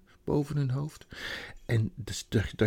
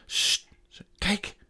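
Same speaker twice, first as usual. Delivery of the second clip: urgent whisper, then a high "kijk"; girl whispering to the others to look; hushed whisper; 10 semitones higher, slower than usual. A narrator's reading. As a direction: hush the others before pointing something out. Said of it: "zei Suzanne".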